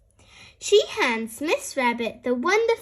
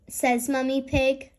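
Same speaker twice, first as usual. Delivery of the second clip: plain reading voice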